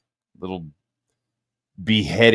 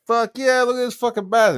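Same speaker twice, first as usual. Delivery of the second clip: high, loud whoop over the fish; excited brag; 14 semitones higher, 5 dB louder than usual. Retold or imitated. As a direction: show off the catch like a proud fisherman. Bragging about a caught fish.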